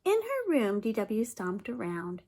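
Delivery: ordinary delivery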